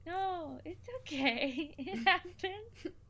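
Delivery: high, slow, sugary reassurance; a sugary fake reassurance over the spilled drink; mock sweet reassurance. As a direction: lay the sweetness on thick. Being nice about the spill.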